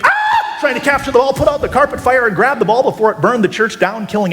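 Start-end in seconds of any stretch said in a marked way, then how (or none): 0.0-0.6 very high yelp laced with laughter; panicked scream. Retold or imitated. Juggler chasing a burning ball across the carpet, high laughing scream. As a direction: yelp as the fire spreads.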